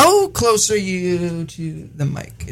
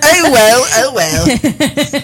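laughing; 6 semitones higher, much slower than usual. Real, not acted. Laughing with the cohost at her British accent. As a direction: laugh the words out.

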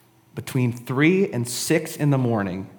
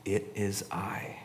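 very soft, slow and low; quiet, gentle declaration; hushed reassurance; 4 semitones lower, 13 dB quieter, slower than usual. Acted. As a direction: say it slowly, almost under the breath. Quotes Jesus's tender, sweetest words.

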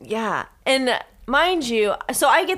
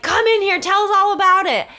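high, raised-voice summons; retold calling over; 8 semitones higher, 5 dB louder than usual. Calling just-woken friend in for gossip, retold call. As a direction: call a friend in from the next room.

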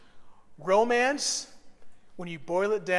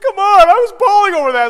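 much higher, much louder mock protest; mock indignant protest; 12 semitones higher, 18 dB louder than usual. Pastor voicing himself defending the card to his wife, high loud protest. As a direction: protest loudly, hurt and high. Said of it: "I'm like".

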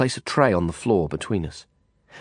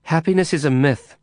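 higher, sharp retort; angry snap; 7 semitones higher, 3 dB louder than usual. Acted. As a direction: bite back at her. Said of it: "Carla snapped back angrily".

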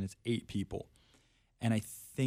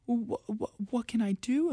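high, stammering mother's voice; flustered mom; 14 semitones higher than usual. Retold or imitated. Mom wondering how to help her bullied kid, high flustered voice. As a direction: voice the mom at a loss. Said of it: "And she's like".

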